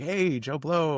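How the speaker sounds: high-pitched, delighted hail; Bob Odenkirk greeting. Retold or imitated. Actor spots a familiar interviewer at the junket, delighted greeting. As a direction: imitate an actor happily greeting someone he knows. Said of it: "he knew me. He was like".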